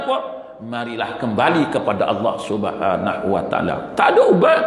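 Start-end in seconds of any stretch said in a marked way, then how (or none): 4.0-4.6 much higher and louder, a sudden cry; preacher warning the congregation, high and loud; exclaimed. Real, not acted. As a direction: burst out with it in alarm.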